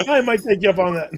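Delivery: amused, through laughter. Real, not acted. Business owner playing along with repo man joke, laughing. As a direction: say it as the laughter tails off. Still laughing at the joking offer.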